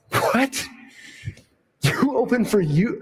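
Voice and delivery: breathy high what, then slow disbelief; stunned disbelief. Retold or imitated. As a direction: let the shock drag out slowly. Hearing [name] opened for U2.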